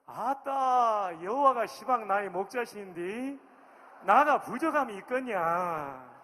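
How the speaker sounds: sing-song Jeolla accent with a long drawn 아따; Jeolla dialect. Shown as a demonstration. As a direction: Jeolla accent, exuberant and loud. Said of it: "이제 전라도 버전입니다".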